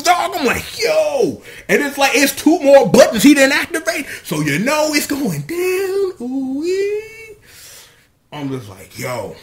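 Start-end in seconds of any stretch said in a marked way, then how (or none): 0.0-7.4 hype. Real, not acted. Hyped reaction to fight scene.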